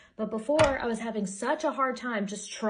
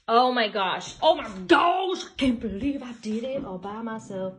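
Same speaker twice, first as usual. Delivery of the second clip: thrilled; 2 semitones higher, 7 dB louder than usual. Real, not acted. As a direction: marvel at what you built alone. Thrilled at finished table.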